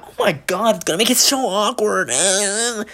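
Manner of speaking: high, mock-whiny complaint; hammed-up juvenile whine; whining kid. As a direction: imitate a childish friend fretting. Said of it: "Stop being a fucking kid".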